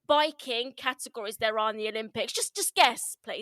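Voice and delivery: loud, emphatic exasperation; indignant, insistent challenge; comic angry rant. Real, not acted. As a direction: demand an answer, worked up. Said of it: "Angered. So I'll try".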